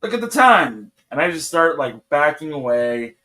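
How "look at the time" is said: mock excuse. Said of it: "So I'm like".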